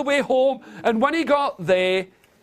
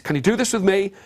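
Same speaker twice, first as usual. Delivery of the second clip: usual speaking voice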